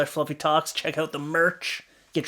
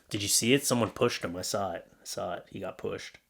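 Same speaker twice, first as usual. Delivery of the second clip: low, much softer conspiratorial murmur; hushed aside; 7 semitones lower, 8 dB quieter than usual. Real, not acted. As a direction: lean in and keep it hushed.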